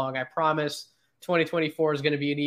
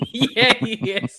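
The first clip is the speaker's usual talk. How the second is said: laughing; 8 semitones higher, 5 dB louder, much slower than usual. Real, not acted. Laughs at co-host's joke.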